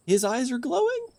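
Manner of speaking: high alarmed question; startled, pitch shooting up; surprised. Real, not acted.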